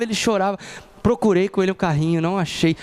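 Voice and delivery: usual speaking voice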